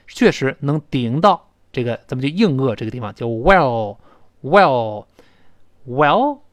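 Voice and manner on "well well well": well, drawn out slowly with the tongue tip on the hard palate; well, said as a slow single word; well, a slow demo ending on a rise; slow model well. Shown as a demonstration. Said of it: "读慢了就读一个单词的话".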